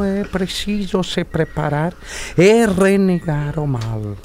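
slow, solemn preacher giving last rites; a priest speaking gently and gravely to a dying man. Acted. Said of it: "Peraí que eu tenho que incorporar".

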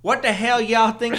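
hammed-up Southern foreman; Southern accent. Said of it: "they don't get an accent?".

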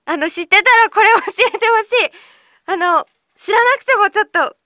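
high, loud eager plea; really want them to tell you; eager appeal. Real, not acted. Host pleading with a listener to explain, eager and loud.

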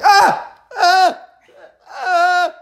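high loud frustrated shouts; frantic yells. Retold or imitated. Player flailing under pressure, shouting high. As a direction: yell out while swinging blind. Swinging blind, being slapped.

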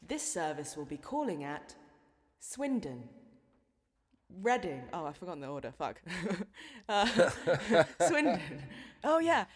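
lightly voiced platform announcer, measured and formal; a hint of the Great Western Railway voiceover; train station announcement. Shown as a demonstration. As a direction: read it like a railway tannoy announcer. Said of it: "I can do a good impression".

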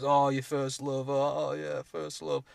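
lightly imitating wistful people, drawn out; a hint of dreamy small talk, slowed slightly; people reminiscing. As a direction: mimic people sighing over young romance. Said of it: "when people always talk about".

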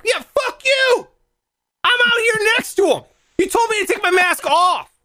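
very high-pitched, squealing protest; hammed-up outrage, voice way up; acted indignant outburst. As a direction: yelp accusingly in mock fury. Acts out yelling at guardsman.

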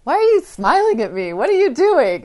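high, loud exasperated "what?"; a twelve-year-old baffled and defensive; suspicious teen. Retold or imitated. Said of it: "And her daughter was saying".

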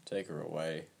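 low, soft, weary whisper. A narrator's reading. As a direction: murmur the dismissal, low and quiet. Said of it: "he whispered".

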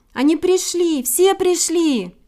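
high, loud, drawn-out cry; thrilled little bird calling out; overjoyed announcing. A narrator's reading. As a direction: announce it in delight, stretching the ending. Bird thrilled that everyone came.

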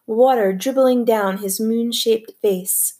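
usual speaking voice

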